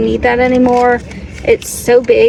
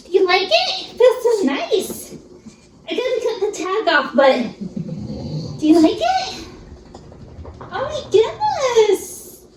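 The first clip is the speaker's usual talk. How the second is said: much higher cooing, some laughs; gushing pet talk; 9 semitones higher than usual. Real, not acted. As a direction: gush over the excited puppy. Dog excited by new bed.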